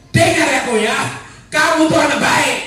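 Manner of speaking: high, hollered mock scolding; demonstrated parental yell. Shown as a demonstration. Mother shouting positive words at her child, high demonstration yell. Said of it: "teriak boleh".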